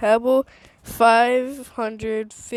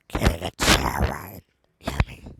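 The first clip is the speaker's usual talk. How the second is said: 1 mock deep voice. Deep put-on voice craving.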